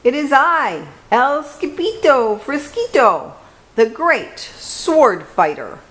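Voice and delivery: put-on high, proud Spanish-accented swordsman; hammed-up sword fighter; bold hero voice. A narrator's reading. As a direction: announce yourself like a grand hero. Hero voice calling to friends.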